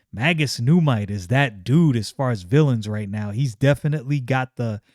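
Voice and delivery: normal talking voice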